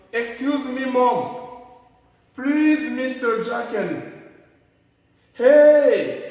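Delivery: high loud hey to someone known; informal call. Shown as a demonstration. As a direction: call out casually to a friend. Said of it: "c'est un peu informel".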